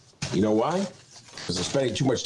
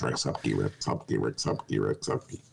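repeated name drill; 5 dB quieter, slower than usual. Shown as a demonstration. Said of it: "that's hard to say, like, ten times".

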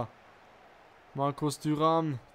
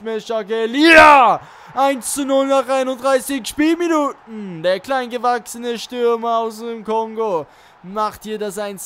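excited goal call; 3 semitones higher, 7 dB louder than usual. Real, not acted. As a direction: roar the goal like a commentator. Own team scores, celebrating.

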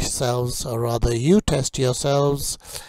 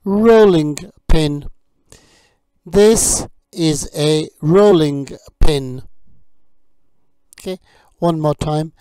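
vocabulary drill; 4 semitones higher, 6 dB louder than usual. Acted. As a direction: model each word for learners to repeat. Teacher drills kitchen vocabulary.

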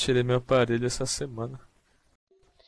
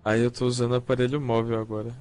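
ordinary talk

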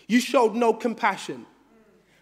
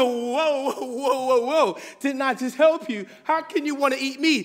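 higher-pitched, hammed-up disbelief; mock alarmed protest; 4 semitones higher than usual. Man protests to the lion he freed, mock alarm. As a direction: play at stopping someone in startled protest.

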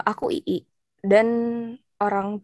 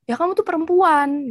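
mock scolding; 7 semitones higher, 4 dB louder than usual. Dad's objection voiced in argument.

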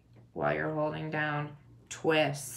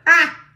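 sudden loud snort of a laugh; 12 semitones higher, 21 dB louder than usual. Real, not acted. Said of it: "Oh, did I just snort?".

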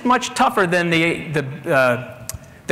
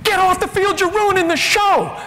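screaming; 11 semitones higher than usual. Retold or imitated. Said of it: "he's screaming".

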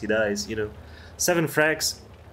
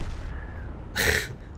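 laugh; 6 dB quieter than usual. Real, not acted. Laughs at own joke.

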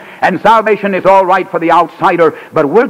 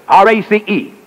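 R A C E spelled out, each letter apart and a little higher; 4 semitones higher than usual. Shown as a demonstration. Preacher spelling out R A C E to his congregation. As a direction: spell out R A C E to hammer the key word. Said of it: "here's the key word".

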